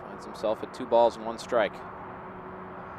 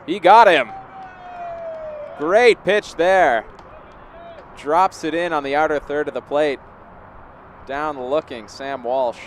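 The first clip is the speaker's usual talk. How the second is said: loud, high burst, then easing down; excited strikeout call; 5 semitones higher, 8 dB louder than usual. Real, not acted. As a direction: shout the big strikeout, stretching the praise. Calling a strikeout live.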